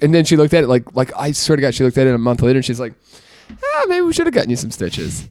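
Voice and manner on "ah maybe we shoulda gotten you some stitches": mock a mom's reluctant admission, softly; imitated mom. Mom belatedly admits stitches were needed.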